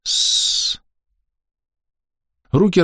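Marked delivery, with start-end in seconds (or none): 0.0-0.8 snake-like hiss. Acted. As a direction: exhale like a snake. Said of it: "по-змеиному выдыхая".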